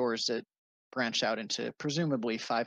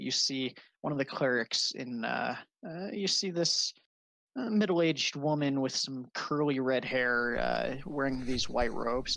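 ordinary talk, nothing marked

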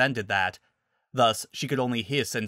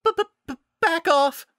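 very high, loud panicky stutter; stutter, then burst out in a squeak; scared, high-pitched warning cry; frightened stammer; 5 dB louder, slower than usual. Acted. Frightened druid raising staff shakily.

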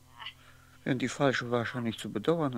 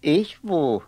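startled shout; 5 semitones higher, 15 dB louder than usual. Acted. Martin startled by accusation.

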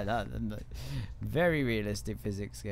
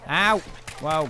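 startled yell; 4 semitones higher, 8 dB louder than usual. Real, not acted. Hit in game.

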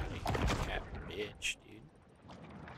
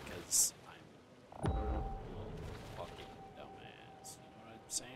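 self-mocking whisper; 5 semitones lower, 12 dB quieter than usual. Real, not acted. Self-mocking aside to viewers.

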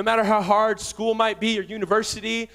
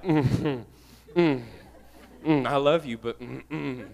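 teasing wife; 7 semitones lower, 4 dB quieter, much slower than usual. Retold or imitated. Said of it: "She's like".

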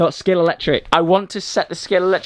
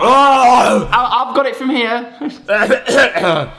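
loud slow announcer; 7 semitones higher, 4 dB louder, much slower than usual. Acted. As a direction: take over like an announcer. Takes over the ad.

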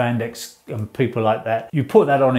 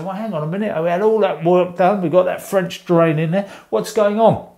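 higher-pitched imitation of a baffled customer; puzzled protest; 6 semitones higher than usual. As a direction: act out a homeowner objecting, voice lifted. Acting out a puzzled homeowner's protest.

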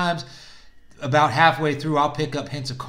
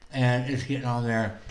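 talking around cigar; puffing while speaking; cigar in mouth. Real, not acted. Seen 1: Cigar held in lips while puffing to light; removed at 2.8.

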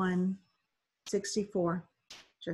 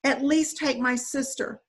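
slow, higher pleading; begging; 6 semitones higher, 4 dB louder than usual. Acted. Boy begs the boat to take his sister, slow pleading. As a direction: plead slowly for the baby sister. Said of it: "Mahmoud begged".

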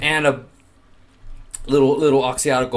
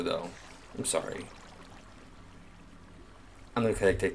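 normal talking voice

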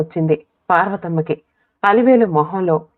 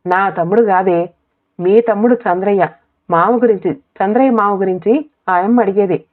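ordinary delivery, nothing marked

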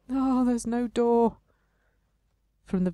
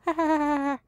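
high spoken ha ha laugh; the tree laughing at the hero; mock tree laugh; 7 semitones higher, faster than usual. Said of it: "The tree is laughing at me".

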